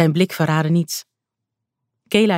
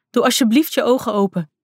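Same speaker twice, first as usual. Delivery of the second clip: pleading; 6 semitones higher than usual. A narrator's reading. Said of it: "riep ze smekend, gillend".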